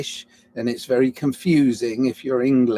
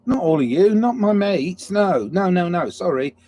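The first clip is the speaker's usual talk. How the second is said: flustered backpedal; 7 semitones higher, 4 dB louder than usual. Real, not acted. Backpedalling apology.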